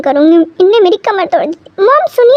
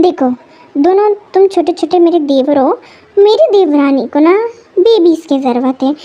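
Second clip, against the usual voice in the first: normal talking voice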